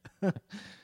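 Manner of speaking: high bright laugh bursting out; burst of laughter. Real, not acted.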